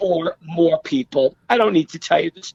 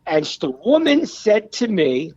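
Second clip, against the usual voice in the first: ordinary delivery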